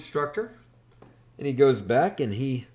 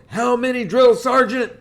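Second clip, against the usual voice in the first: loud, higher sound-off; recruit shouting back; 12 semitones higher, 10 dB louder than usual. Retold or imitated. Recruit sounding off to drill instructor, loud. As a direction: answer like a recruit at attention. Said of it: "I go".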